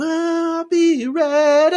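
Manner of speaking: very high, drawn-out heroic lifeguard line; a stretched, squeaky mock-heroic Hasselhoff line; slow-motion lifeguard. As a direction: ham up a Baywatch slow-mo moment.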